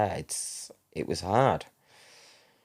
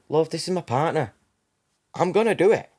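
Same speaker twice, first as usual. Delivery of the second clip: high, emphatic assertion, getting louder; retold insistence; 9 semitones higher, 5 dB louder than usual. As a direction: take charge over a hesitant helper. Retold insisting to trainee midwife.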